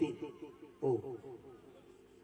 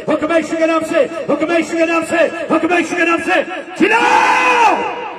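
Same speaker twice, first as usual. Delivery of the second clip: building command shout; 4 semitones higher, 5 dB louder than usual. Real, not acted. Preacher ordering the demon out, rising to a shout. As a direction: chant the command louder and higher each time until a long shouted scream.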